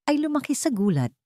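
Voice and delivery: ordinary delivery, nothing marked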